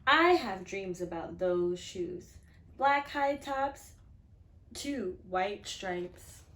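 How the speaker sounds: put-on reading to children. A narrator's reading. Teacher reading a picture book to her class, expressive storytelling. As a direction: read it out to the class with feeling.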